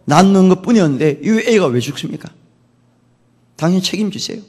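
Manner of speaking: retold complaint with a touch of anger; angry reproach. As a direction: reproach him as an aggrieved mother, mildly. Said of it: "분노를 일으키고".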